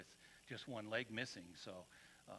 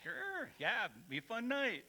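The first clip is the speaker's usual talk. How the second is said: mock naive cheer; 11 semitones higher, 6 dB louder, slower than usual. Echoing their naive younger selves.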